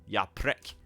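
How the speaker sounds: ordinary talk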